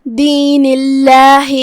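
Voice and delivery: slow دین اللہ with a thin, light ل in اللہ; light lam. Shown as a demonstration. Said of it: "ہم اسے ہلکی آواز میں پڑھیں گے".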